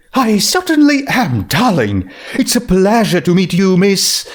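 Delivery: high, grand and gracious; Rarity voice. Acted. Rarity greeting the ghost grandly in a high voice. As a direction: preen a little, lifting the voice high.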